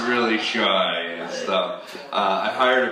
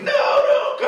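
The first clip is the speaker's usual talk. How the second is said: high, loud mock child shout; much higher, louder begging yelp; kid's plea; 7 dB louder, slower than usual. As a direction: imitate a boy begging dad to stay. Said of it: "he was like".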